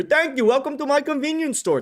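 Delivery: high, far-off mock Indian accent; hammed-up imitation of an Indian shopkeeper accent. Streamer mocking his imagined Indian teacher, in a hammed-up Indian accent. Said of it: "that fucking shitty accent".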